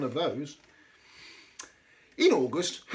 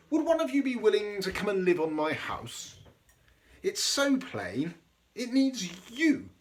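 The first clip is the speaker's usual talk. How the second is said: a higher, lightly voiced little crab asking nicely; gently voiced crab pleading for help; hopeful Hermit Crab; 7 semitones higher than usual. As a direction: ask like a hopeful little crab, slowing at the end. Hermit Crab's pleading requests.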